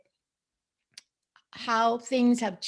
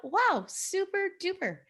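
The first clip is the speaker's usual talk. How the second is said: high-pitched impressed wow; retold amazement; 10 semitones higher than usual. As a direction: react with delighted amazement, much higher. Retold delighted reaction to news.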